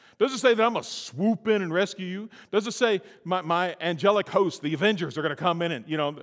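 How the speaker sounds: high, loud preaching; preaching at full force. Real, not acted. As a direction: drive it home from the pulpit.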